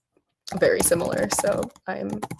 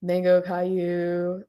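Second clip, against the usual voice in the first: ordinary talk, nothing marked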